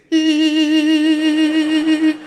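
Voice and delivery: very loud, high continuous squeal; mock metal-detector laugh; mum's run-together laugh. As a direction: imitate hysterical laughter as one long alarm. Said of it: "All the ha's come together very quickly".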